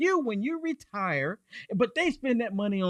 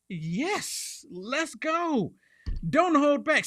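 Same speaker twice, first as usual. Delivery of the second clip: eager Musk; 8 semitones higher, much slower than usual. Retold or imitated. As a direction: fire up like he's been unleashed. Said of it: "Elon Musk is like".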